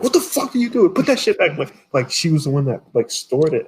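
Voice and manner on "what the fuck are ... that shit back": high-pitched mock-scolding mother; much higher voice, exasperated parent; scolding mom impression. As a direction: imitate a mom telling you off. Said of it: "and then my mom's like".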